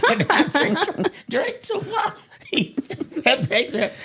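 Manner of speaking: high, laughing through the words; can't stop laughing as you talk. Real, not acted. Retelling the prank to family, laughing through it. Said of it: "And I got to laughing".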